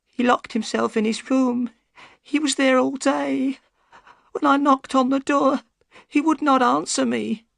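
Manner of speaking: put-on high, pleading old woman's voice; a scared servant begging for help; frightened old housekeeper. A narrator's reading.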